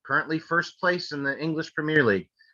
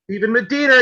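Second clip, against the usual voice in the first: loud, high winner call; hyped giveaway announcement; 9 semitones higher, 9 dB louder than usual. Real, not acted. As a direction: shout the winner's name.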